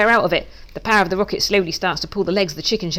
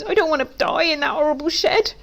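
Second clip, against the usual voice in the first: tearful dread; 9 semitones higher than usual. A narrator's reading. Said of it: "tears start to fill up my eyes".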